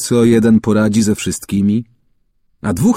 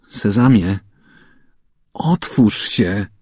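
slow pleading; slower than usual. A narrator's reading. Boy tries magic words again.

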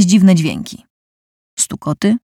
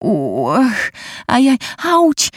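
effort grunt; 6 semitones higher, much slower than usual. Acted. Labour pain begins.

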